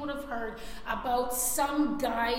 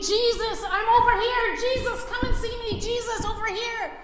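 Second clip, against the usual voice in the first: loud, high, desperate calling across a crowd; shouting for attention; 7 semitones higher, 7 dB louder than usual. Retold or imitated. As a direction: beg Jesus to notice you, full voice. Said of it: "He's calling out his name".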